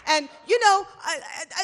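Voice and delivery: ordinary delivery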